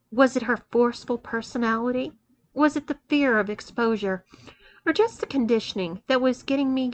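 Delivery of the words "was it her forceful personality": usual speaking voice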